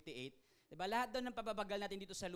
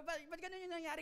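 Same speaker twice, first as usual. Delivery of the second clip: baffled cook; 10 semitones higher than usual. Retold or imitated. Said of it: "Di ba?".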